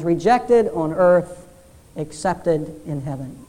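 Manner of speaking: slow, weighty telegram words, dropping low; solemn slow reading. Retold or imitated. Pastor reading the father's telegram to the congregation, slow and solemn. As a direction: read the father's wire slowly, with weight. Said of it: "the wire read like this".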